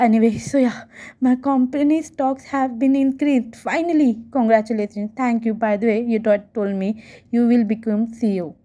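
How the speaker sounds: usual speaking voice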